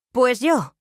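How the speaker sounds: a sharp clipped reply that cuts him off; curt, cutting. A narrator's reading. Luisa cuts her husband off, cutting. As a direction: snap back at him, short and firm. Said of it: "dije cortante".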